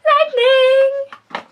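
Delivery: high, loud announcer-style cry of the name; dramatic shout. Pretend. Beauty vlogger shouting the product name at camera, high and loud. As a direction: belt the product name like a superhero. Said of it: "Okay, I need to get out more".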